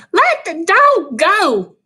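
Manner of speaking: loud, high, slow shout; screaming. A narrator's reading. Cal screaming at Wolf to free Dog. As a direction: yell to save the dog. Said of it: "Cal screamed".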